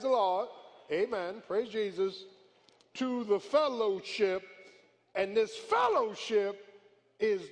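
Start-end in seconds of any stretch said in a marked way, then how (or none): none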